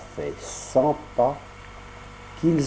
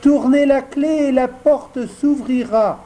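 much higher, louder tiny voice from inside; small voice; 12 semitones higher, 4 dB louder than usual. Acted. A small voice inside answers the knock, high and thin. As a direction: call out thinly through the door. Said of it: "dit la même petite voix".

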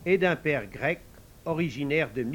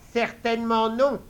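indignant refusal; 8 semitones higher, 7 dB louder than usual. Acted. Queen mother refuses sharply.

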